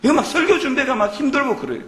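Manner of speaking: normal talking voice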